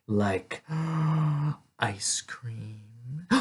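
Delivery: gasp, then a hushed, breathy whisper; awed longing. A narrator's reading. Storyteller listing treats her father never buys, awed whisper. Telling longed-for treats with gasps.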